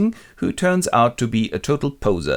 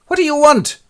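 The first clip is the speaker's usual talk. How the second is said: much higher, louder snappy woman's voice; mock shrill neighbor; 13 semitones higher, 7 dB louder than usual. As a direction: bark at her in a high shrill voice. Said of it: "her neighbor goes".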